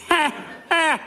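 high witchy cackle. Acted. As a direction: cackle in triumph. Witch gloats.

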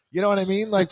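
normal talking voice

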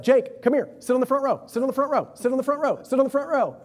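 loud, high, sharp yelling call. Pretend. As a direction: shout it at him across the room. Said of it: "Why are you yelling at Jake?".

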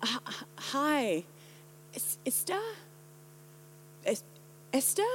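halting, puzzled attempt at an unfamiliar name; confused greeting. Baffled American trying to repeat her name, hesitant. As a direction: stammer it softly, rising into a question. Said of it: "and they would say".

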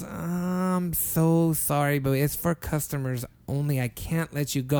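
slow, drawn-out imitated apology; apologetic refusal. Cashier turning away a desperate man, slow apology. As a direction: stretch out the sorry, reluctant to say no.

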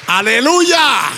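long, slow, loud high aleluya; exultant hallelujah. Real, not acted. Pastor praising before the church, drawn-out loud exclamation. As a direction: stretch it out in praise.

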